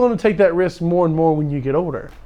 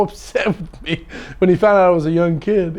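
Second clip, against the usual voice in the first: usual speaking voice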